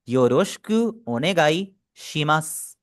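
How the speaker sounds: योरोशिकु ओनेगाई शिमास said slowly with योरोशिकु as yo-rosh-ku; teacher modelling the full phrase; slow model reading. Shown as a demonstration. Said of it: "ये इस तरह पढ़ा जाएगा".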